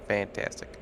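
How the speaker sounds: ordinary talk, nothing marked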